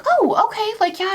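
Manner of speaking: high, sweet, eager to help; bright helpful customer-service chirp; nice lady voice. Retold or imitated. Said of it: "And she was so nice".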